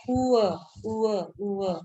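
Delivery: "उ अ" repeated slowly, oo sliding into short a; slow repeated glide on "उ अ"; sound-blending demo. Shown as a demonstration. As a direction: draw out "उ अ" so a va sound appears. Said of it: "व की sound आ रही है".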